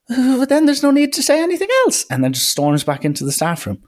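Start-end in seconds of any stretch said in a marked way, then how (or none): 0.1-2.0 much higher, huffy mock teacher voice. Teacher snapping at the young coach. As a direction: play the indignant teacher, pitch way up. Teacher snaps before storming off.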